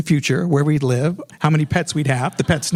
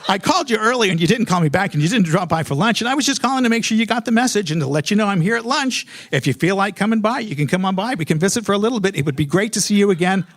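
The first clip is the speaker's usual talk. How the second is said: higher-pitched, needy phone message; trying to sound casual, audibly needy; desperate, clingy caller; 5 semitones higher than usual. Retold or imitated. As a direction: re-enact a run-on, overeager voicemail. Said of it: "I did feel like I sounded a little bit desperate".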